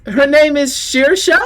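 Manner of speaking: high, loud, slow questioning gentleman; puzzled gentleman. Acted. Gentleman at the door checking the visitor's name. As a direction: draw the name out in doubt. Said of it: "the gentleman goes".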